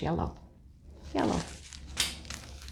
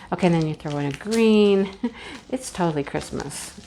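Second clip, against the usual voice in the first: normal talking voice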